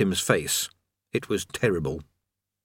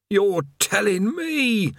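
slow, higher worn-out voice; weary prisoner griping; haggard chained man; 10 semitones higher, much slower than usual. A narrator's reading.

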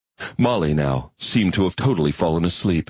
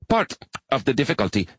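higher, earnest insect-like alien voice; high alien arachnid; 6 semitones higher, faster than usual. Acted. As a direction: play an eager, bookish spider creature.

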